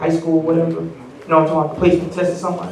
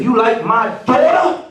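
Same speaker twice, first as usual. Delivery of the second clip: dad interrogating; 9 semitones higher than usual. Retold or imitated. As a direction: lean in hard on the kid. Dad confronting the boy.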